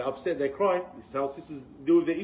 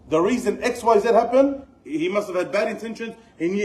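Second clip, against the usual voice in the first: indignant rant; 5 semitones higher, 5 dB louder than usual. Acted. Voicing the brother's assumptions.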